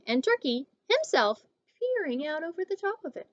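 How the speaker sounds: ordinary delivery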